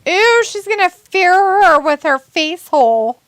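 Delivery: disgusted heckle at the TV; mock disgust. Real, not acted. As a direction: sneer at the wrestler.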